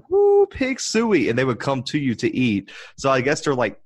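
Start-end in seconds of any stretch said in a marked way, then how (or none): 0.1-1.3 long high drawn-out hog call. Retold or imitated. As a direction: call the pigs in like at a game. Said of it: "you'd be like".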